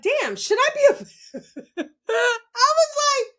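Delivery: high, laughing disbelief; baffled and amused. Retold or imitated. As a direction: giggle out the question in a squeaky voice. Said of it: "I was just like".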